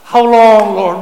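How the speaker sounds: loud, high pleading to God; a psalmist crying loudly how long. Acted. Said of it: "One of the cry of the psalmist".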